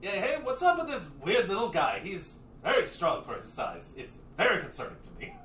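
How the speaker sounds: light brash brother voice; lightly voiced Lupe. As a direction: shrug it off, amused. GM voicing Lupe.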